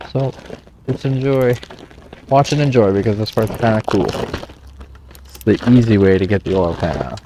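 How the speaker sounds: talking while eating; words around a mouthful; chewing noisily. Real, not acted. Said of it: "And I'm really sorry I'm eating".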